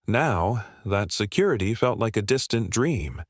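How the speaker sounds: plain reading voice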